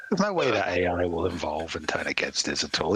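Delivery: normal talking voice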